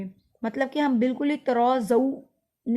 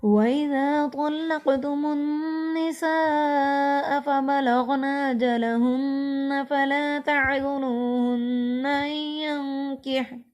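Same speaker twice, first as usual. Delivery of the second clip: slow melodic Quranic chanting; Quran recitation; 2 semitones higher, much slower than usual. Acted. Teacher reciting the whole verse fluently for students, melodic chant.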